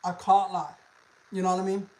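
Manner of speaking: ordinary talk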